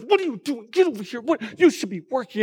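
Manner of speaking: mildly imitated department-store boss; scolding store worker. Store worker rebukes Elf for idling, mild mock scolding. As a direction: lightly mimic a worker telling someone off. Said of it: "he starts getting rebuked".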